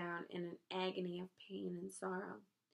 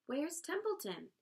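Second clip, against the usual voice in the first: high, urgent demand; 8 semitones higher than usual. A narrator's reading. Wilbur hunting for the rat, demanding. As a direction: demand an answer, pitched up. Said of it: "he demanded".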